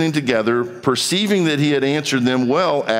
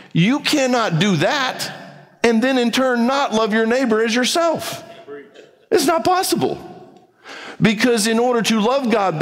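fervent insistence; 9 semitones higher than usual. Real, not acted. Preacher insisting on the point.

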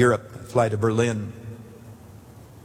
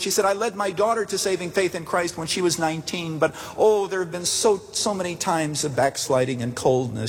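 higher-pitched voicing of a distressed old lady; elderly woman voice, upset; 7 semitones higher than usual. Pastor voices the crying elderly woman.

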